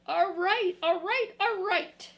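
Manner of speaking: high, loud, flustered monster-book cry; loud Grover shout. Acted. Grover yelling at the child turning pages, high and loud. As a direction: yell it out as a panicky furry monster.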